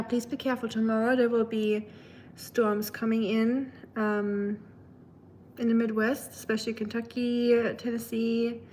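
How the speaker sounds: normal talking voice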